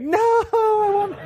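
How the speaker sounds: very high, long mock toddler wail; imitated crying. Dad imitating daughter refusing his candy deal, high mock bawling. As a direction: stretch it into a loud, hammed-up bawl. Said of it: "so she keeps on crying".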